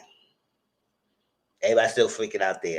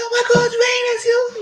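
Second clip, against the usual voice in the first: high, loud, teary shriek; shocked scream; 7 dB louder than usual. Acted. Kimmy hearing Rain alive, shrieking high. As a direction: scream it in disbelief.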